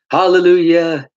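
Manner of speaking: hyped-up church-salesman exclamation; mock salesman hallelujah. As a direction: belt out a preachy hallelujah, imitating. Imitates the salesman's church pitch.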